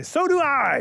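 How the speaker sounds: high little mole voice. Acted. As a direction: pipe up as a tiny creature, pitched high. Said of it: "he hears a little voice say".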